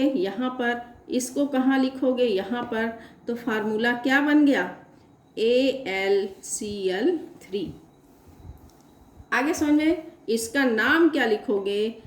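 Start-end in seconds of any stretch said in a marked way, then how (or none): 5.4-7.7 very slow, drawn-out letters; slow dictation. Real, not acted. Teacher writing the finished formula, slow dictation. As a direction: spell out each letter for note-takers.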